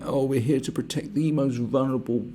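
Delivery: high prissy mock voice; mock sanctimonious do-gooder voice; mocking flouncing do-gooder. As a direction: lampoon a preachy campaigner. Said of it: "flounce around saying".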